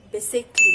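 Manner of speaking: teacher modelling basically; correct pronunciation. Shown as a demonstration. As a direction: announce basically loudly, bay-sik-lee. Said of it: "the next word is".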